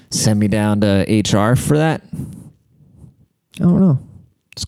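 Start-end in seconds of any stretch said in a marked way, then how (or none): 2.1-4.0 very slow, high stretched I don't know; mock-puzzled slow drawl; slow drawn-out shrug. Real, not acted.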